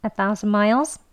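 "A thousand miles" run together quickly; quick, linked delivery; fast connected speech. Shown as a demonstration. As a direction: say it at natural native speed. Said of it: "빨리 발음하시면요".

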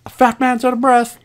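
high-pitched silly voice; mock announcement. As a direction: pitch it way up, joking at your own breathlessness. Self-mocking repeat in silly voice.

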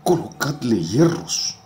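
high loud bellow; vociferous shout. Acted. Mob to the guards, high loud bellow. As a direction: roar for the chains. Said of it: "otros vociferan".

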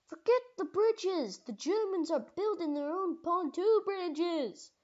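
high, loud battlefield warning; urgent shout. Acted. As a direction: call it out urgently over the battle. Urgent battlefield warning in acted scene.

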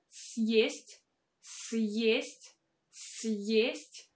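съесть with a clear y glide before e; y sound in съесть. Shown as a demonstration. Teacher repeating съесть, y glide after hard sign. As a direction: say съесть as s-yest, stressing the y. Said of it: "you can clearly hear this sound y".

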